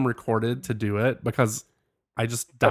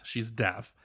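usual speaking voice

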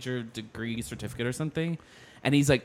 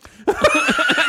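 high, loud burst of laughter; real shrieking cackle; laughing; 8 dB louder than usual. Real, not acted. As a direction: crack up at your own impression. Cracks up after her impression.

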